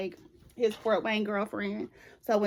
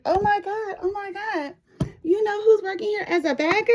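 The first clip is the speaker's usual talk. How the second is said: high, gushing gossip voice, much higher; excited coworker gossip; 10 semitones higher, 4 dB louder than usual. Retold or imitated. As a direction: squeal it like a giddy coworker. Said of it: "she was like".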